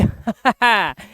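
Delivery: short burst of laughter; amused teacher's chuckle. Real, not acted. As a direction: laugh mid-explanation. Teacher laughs at the odd rule.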